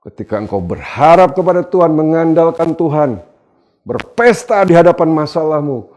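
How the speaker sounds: high, loud exhortation; full-force preaching. Real, not acted. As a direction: preach it in driving, insistent phrases.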